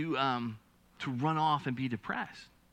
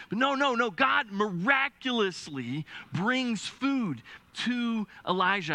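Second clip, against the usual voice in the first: higher and slower, leaning hard on each word; emphatic insistence; 8 semitones higher, slower than usual. Real, not acted. Preacher correcting his own joke about bird food, emphatic. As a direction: jump up and drive it home slowly.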